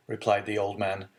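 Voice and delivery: plain reading voice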